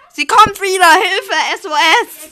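high, loud fake alarm; mock panic. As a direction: cry for help playfully. Mock panic as friend returns.

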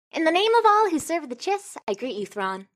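high, fast, grandly theatrical greeting; theatrical noble greeting. Acted. Chafra Nasir grandly greets Thrawn, high and theatrical. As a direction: sweep into a showy, over-the-top welcome. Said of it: "and says".

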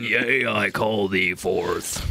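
very slow, laughing summoning chant; deep summoning voice. Retold or imitated. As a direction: draw it out like an incantation. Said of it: "when he goes deep, it's fun".

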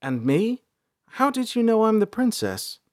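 high-pitched princess, asking timidly; sheepish. A narrator's reading. Princess asks how she was recognised, sheepishly. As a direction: ask sheepishly, rising high. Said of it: "April stepped forward sheepishly".

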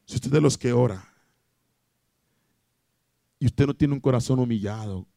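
normal talking voice

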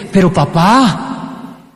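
long, dragged-out plea to dad; acted teenage objection, drawn out slowly; son's drawn-out protest. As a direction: stretch it out as a kid's protest. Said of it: "en realidad me está diciendo es".

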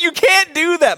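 high-pitched retold objection; indignant protest. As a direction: imitate an outraged player objecting. Retold protest during board game quarrel.

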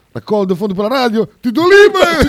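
high, loud, laughing hype voice; Sighi impression. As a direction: shout it like an eager fundraiser. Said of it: "arriva Sighi".

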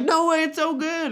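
high, loud indignant protest, lightly voiced; mock protesting husband. Husband insisting the show is great, mock protest. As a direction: defend the show, hammed up. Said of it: "And he'd be like".

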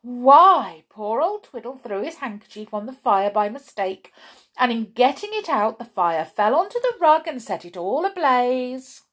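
high, loud breathless gossip; gossip crying out. Acted. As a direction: rush out the juicy news. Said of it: "she cried".

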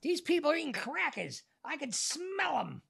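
high, slow whiny accusation; imitating a confused old man. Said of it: "Like this".

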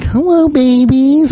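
put-on high, slow cooing baby talk; high, slow cooing at the stray cats. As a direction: coo at the cats like a doting idiot. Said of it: "I talk like an idiot".

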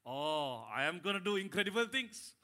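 puffed-up, laughing brag; mock boastful voice, laughing; prideful boast. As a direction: hear it as a proud boast with a laugh. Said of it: "Sometimes what we hear is this".

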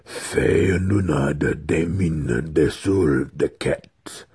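very slow spell chant; incantation. A narrator's reading. Wizard resumes his incantation, slow solemn chanting. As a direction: intone it, drawing out every syllable. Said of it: "he began again".

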